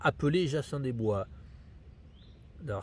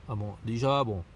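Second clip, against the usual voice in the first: ordinary delivery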